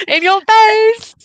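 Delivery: high, slow taunting voice; gloating taunt. Pretend. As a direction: rub it in, sing-song. Gloating at husband's insult.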